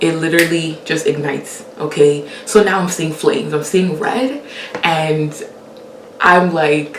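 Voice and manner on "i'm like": ordinary talk, nothing marked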